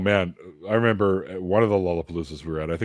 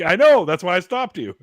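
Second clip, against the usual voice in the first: high, loud comic reply; retold emphatic retort; 10 semitones higher, 8 dB louder than usual. Teen to dad who nearly smacked him, retold retort. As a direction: fire back high and emphatic.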